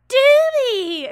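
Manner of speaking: put-on high, loud, strained whiny teen yelp; whiny strained child. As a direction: squeal it like a whiny kid. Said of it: "sounding like a strained child".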